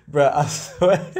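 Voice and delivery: laughing while talking. Real, not acted. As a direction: speak through real laughter. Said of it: "You see me laughing here?".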